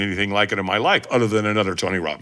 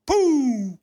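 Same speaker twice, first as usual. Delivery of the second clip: launch noise; 12 semitones higher than usual. Retold or imitated. Sound of taking off.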